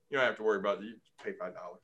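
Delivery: low, soft mock reassuring clerk; hospital clerk soothing a worried patient, mock reassuring; billing clerk voice. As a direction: lull them like a smooth hospital clerk.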